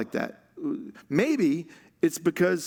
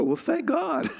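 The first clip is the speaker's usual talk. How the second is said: higher voice, imitating relieved listeners; mock relief; 11 semitones higher than usual. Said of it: "some of us will go".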